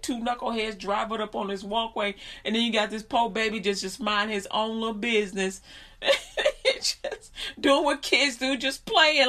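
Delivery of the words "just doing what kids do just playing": high voice strained by laughter; amused, laugh-squeezed telling. Real, not acted. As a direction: talk on through real giggles. Still laughing through the story.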